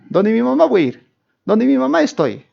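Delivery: high-pitched mock clinging child; mama's child imitation. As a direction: mimic someone helplessly attached to mamá. Mocking people tied to mother's apron.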